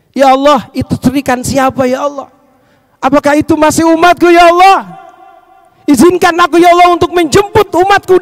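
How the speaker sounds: acted plea, climbing in pitch; urgent pleading. Prophet begs leave to fetch his follower, mildly urgent pleading. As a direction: build from a question to a high appeal.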